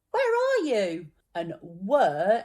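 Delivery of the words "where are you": high, louder call of where are you. Shown as a demonstration. Teacher demonstrating where by calling out to someone. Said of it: "as in".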